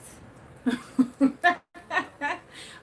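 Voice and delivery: a high, loud burst of laughter at her own joke. Real, not acted. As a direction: crack up at the joke about the wife. Joking about wife as enemy.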